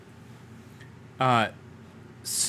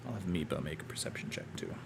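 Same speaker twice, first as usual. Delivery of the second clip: whispered aside; 7 semitones lower, 15 dB quieter, much faster than usual. Real, not acted. DM aside while rolling.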